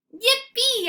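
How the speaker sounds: very high, loud squeal of joy; joyful dog yelp; yippee bark. A narrator's reading. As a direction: yelp with glee. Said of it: "I bark".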